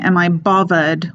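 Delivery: "bothered" said with a V, as bovvered. Acted. Quoting a woman in a picture, "bothered" as Cockney bovvered. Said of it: "with two V's".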